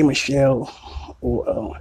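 normal talking voice

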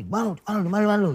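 lightly voiced wife demanding to know where he is; mock nagging wife. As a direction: mimic a wife pestering her tired husband. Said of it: "Bini lu nanya".

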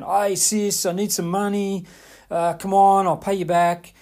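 cajoling sibling voice; brother begging. Retold or imitated. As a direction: play the pushy younger brother asking for cash. Brother asking sister for money.

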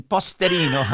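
loud "Posterino" said the Italian way; Italian pronunciation. Shown as a demonstration. Host laughingly correcting the guest's surname, Italian pronunciation. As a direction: stress the "ri" in "Posterino", Italian-style. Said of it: "It's Italian".

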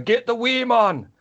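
high, slow Scottish shout of a nickname; mock Scottish yell across the pitch; Scottish accent. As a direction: call it out like a Scottish teammate on the field. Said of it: "Slightly offensive accent there".

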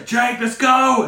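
loud, high, drawn-out call; mock holler, slow and high-pitched; imitated shout. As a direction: re-enact the player's shout at full voice. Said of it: "So you say".

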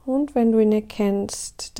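ordinary talk

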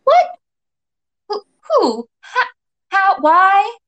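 disjointed bursts, climbing high; bewildered, broken-up blurting; sputtering questions. A narrator's reading. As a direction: stammer out each word in confusion. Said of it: "Your questions sputter out".

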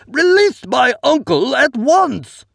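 high, loud, slow desperate command; desperate cry. A narrator's reading. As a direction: beg and order at once. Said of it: "a hoarse, desperate cry".

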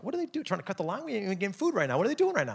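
high, puzzled mock disbelief; confused onlookers. People watching guys rush the door, puzzled and high. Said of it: "They're like".

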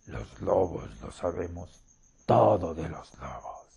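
a low beastly rumble from the wolf. Acted. The wolf answering the hostess.

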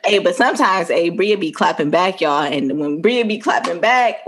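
ordinary delivery

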